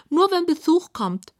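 high-voiced storybook pig; high little pig voice; pig character. A narrator's reading. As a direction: answer in a high piggy voice. The pig answers Clara.

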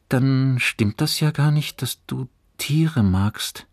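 usual speaking voice

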